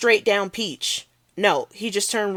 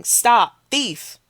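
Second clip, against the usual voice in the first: higher, louder cry of alarm; shouting; 6 semitones higher, 6 dB louder than usual. Acted. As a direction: yell after the thief. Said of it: "a guy down the street shouting".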